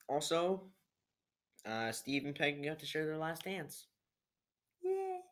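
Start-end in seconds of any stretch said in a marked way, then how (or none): 4.8-5.2 mock yay. Real, not acted. Cheers the last dance.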